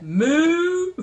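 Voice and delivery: high, loud, rising moo; cow moo. Retold or imitated. Grandma demonstrating something like a cow, mooing. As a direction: give a comic cow imitation. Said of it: "Something like that".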